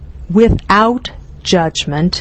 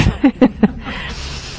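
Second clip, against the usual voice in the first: airy giggle; 3 semitones higher, 4 dB quieter than usual. Real, not acted. Laughs at own cute demo.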